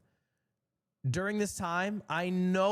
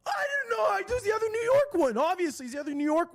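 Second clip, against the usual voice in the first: high, louder sobbing whine; mock crying wail; 4 dB louder, faster than usual. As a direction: wail like a kid being wronged.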